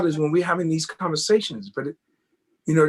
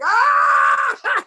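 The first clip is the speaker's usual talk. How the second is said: high, loud imitated crowd whoop; mock laughing shout; 6 dB louder than usual. Dancer imitating the crowd's roar at his defeat, high whoop. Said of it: "everybody laughing like".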